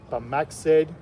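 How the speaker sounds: usual speaking voice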